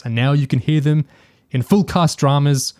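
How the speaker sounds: usual speaking voice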